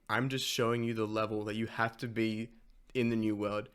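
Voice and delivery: ordinary talk, nothing marked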